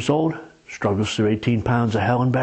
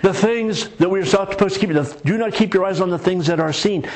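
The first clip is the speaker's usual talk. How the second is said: ordinary delivery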